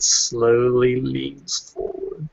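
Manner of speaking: slow, stretched-out words; drawn-out slowness. Acted. Player describing his character creeping forward, slowly drawn out. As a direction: stretch SLOWLY out long to match the motion.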